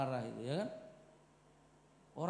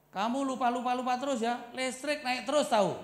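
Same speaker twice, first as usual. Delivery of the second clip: louder, higher nagging husband; mock scolding; 11 semitones higher, 8 dB louder than usual. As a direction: nag her like a fed-up husband. Husband scolding forgetful wife.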